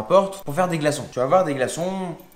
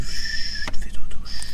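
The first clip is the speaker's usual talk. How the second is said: whispering over a crib; 6 dB louder, slower than usual. Pretend. Putting ice trays to sleep.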